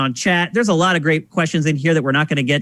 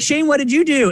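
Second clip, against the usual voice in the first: questioning grandparent; 9 semitones higher than usual. Retold or imitated. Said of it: "And they're like".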